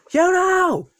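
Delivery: high-pitched cry of a name; calling out. Acted. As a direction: cry out for your friend in danger. Crying out to attacked friend.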